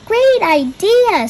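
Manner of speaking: high, slow, bright cheer; a child thrilled by mom's plan; excited. A narrator's reading. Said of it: "says Pete".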